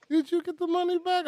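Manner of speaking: high-pitched wife impression; mock wifely question in falsetto; imitated wife. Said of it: "My wife was like".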